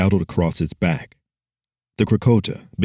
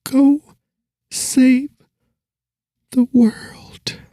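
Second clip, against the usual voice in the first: put-on much higher girl's voice, sinking to a whisper; dying girl's last words; 3 dB louder, much slower than usual. Dying girl sending the hero off, high and whispered. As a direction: spend the last breath on it.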